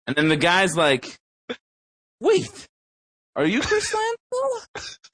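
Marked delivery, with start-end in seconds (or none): 2.2-5.0 very high, drawn-out imitation of an amazed stranger; mock astonishment. Imitating a player recognizing Chris Lansdell, mock astonishment. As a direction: squeak the question slowly, hamming up disbelief.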